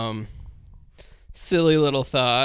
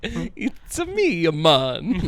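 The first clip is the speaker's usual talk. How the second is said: high, slow Italian-Jamaican mock accent; jokey Italian Rasta accent mash-up; 6 semitones higher, much slower than usual. Friend spoofing an Italian Rasta with a high silly accent. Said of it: "I was about to do the accent".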